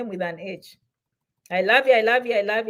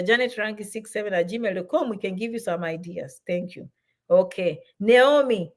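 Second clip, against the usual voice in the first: ordinary talk, nothing marked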